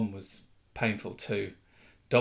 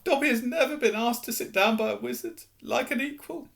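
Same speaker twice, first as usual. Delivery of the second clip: high, tearful elf voice; amazed at being treated as an equal; moved house elf; 10 semitones higher than usual. Acted.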